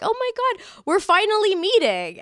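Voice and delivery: high, bright-eyed squeal of a first meeting; excited greeting. Retold or imitated. Greeting a fan at the bridal shower, high and gushing. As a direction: gush like meeting an idol at last. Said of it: "And you were like".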